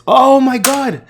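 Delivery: sudden loud excited gasp-out; surprised. Real, not acted. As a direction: blurt it out in shock. Sudden recognition.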